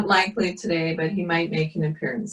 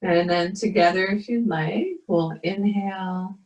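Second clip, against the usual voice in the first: guided meditation; 2 semitones higher than usual. Acted. Leads a yoga meditation.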